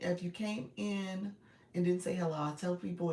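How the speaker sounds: usual speaking voice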